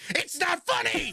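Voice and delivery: high, drawn-out indignant cry; mock angry shriek. Teller imitates mad British woman scolding his laughter, mock shriek. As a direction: imitate her fury, slow and high-pitched. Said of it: "genuinely really mad".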